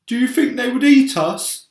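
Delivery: a gloomy worm dreading the worst; nervous earthworm. Acted. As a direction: earthworm voice, anxious. Earthworm asking in fear.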